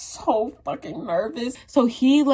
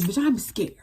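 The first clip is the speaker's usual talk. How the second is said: ordinary talk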